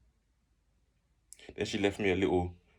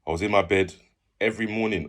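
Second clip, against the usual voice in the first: ordinary delivery, nothing marked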